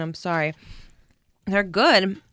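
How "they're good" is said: chewing while talking; mouth full. Real, not acted. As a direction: say it through a chewy gummy. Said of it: "chewing gummies in a microphone".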